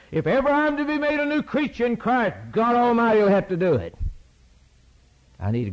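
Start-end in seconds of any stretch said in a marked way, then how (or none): 0.1-3.9 preaching climax. Real, not acted. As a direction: build the fervour to God Almighty. Climactic preaching build, repeated refrain.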